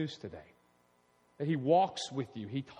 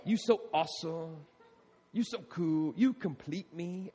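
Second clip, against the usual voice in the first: lightly voiced mock lover crooning; a hint of a syrupy sweet-talker; 3 dB quieter, slower than usual. Pastor mocking a dating partner's sweet nothings, mildly crooned. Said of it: "whispering sweet nothings in your ear".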